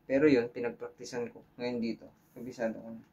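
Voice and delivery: ordinary delivery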